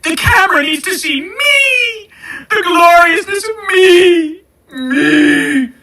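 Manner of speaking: very high, drawn-out mock wail; hammed-up diva. Host mocks an attention-craving would-be influencer, hammed-up diva wailing. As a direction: stretch each phrase into a shrieking parody of vanity.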